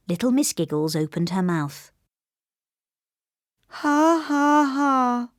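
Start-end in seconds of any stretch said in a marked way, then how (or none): 3.8-5.3 high, joyless ha ha; doleful fake laugh. A narrator's reading. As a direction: laugh without any joy. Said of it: "she said dolefully".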